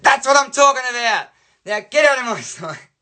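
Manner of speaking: high, hammed-up outburst; mock a teacher losing her temper; angry teacher. Teacher ordering student out of sight, exasperated and angry.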